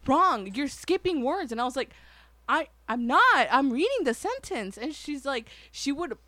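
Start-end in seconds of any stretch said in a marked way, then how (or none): 0.1-1.5 high, loud scolding; retold teacher yelling. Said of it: "why she was yelling at me". 2.5-4.8 mock indignant stammered denial; protesting child. Child arguing back at teacher, retold.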